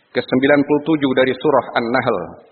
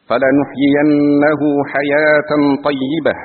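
Quran recitation; 3 semitones higher, 4 dB louder than usual. Real, not acted. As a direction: recite the verse with long held vowels and raised pitch. (tilawah ayat dengan bacaan panjang)

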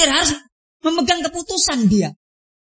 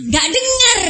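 high, drawn-out call; strained high call, stretching the last word; calling over waves; 10 semitones higher, slower than usual. Shown as a demonstration. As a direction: pitch it high as if over noisy surf. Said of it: "Pantai itu rame dengan ombak".

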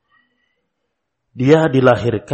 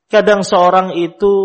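ordinary delivery

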